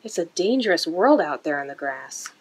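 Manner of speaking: ordinary delivery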